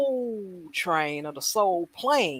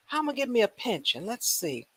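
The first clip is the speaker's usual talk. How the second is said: usual speaking voice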